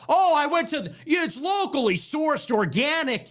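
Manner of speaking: high, breathless farmers' market snob; prissy boast about organic; mock snob. Comic mocking a farmers' market shopper's organic boast. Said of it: "They go".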